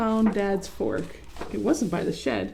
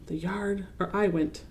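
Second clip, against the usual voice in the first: plain reading voice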